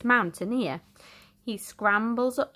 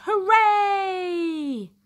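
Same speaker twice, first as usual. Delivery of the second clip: high, louder cheer; cheering sailors; 9 semitones higher, 4 dB louder than usual. Acted. Sailors cheering the rescue, joyful. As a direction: cheer in triumph. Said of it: "as the sailors shout".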